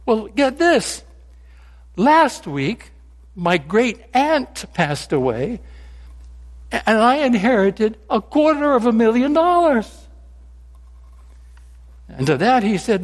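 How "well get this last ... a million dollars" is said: a hint of a friend topping his own story; Frank in a joke. Frank tells his friend about his biggest inheritance, lightly acted.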